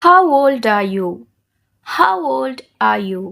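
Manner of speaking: very slow, clearly spaced words; slow, careful model for repeating; slow shadowing model. Shown as a demonstration. Teacher models questions slowly to shadow. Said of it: "high and low-గా చెప్తాను".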